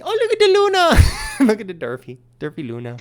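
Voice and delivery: put-on high, loud sing-song baby talk; mock him with a high cooing voice. Neighbor mocking the dog owner, high, loud, cooing.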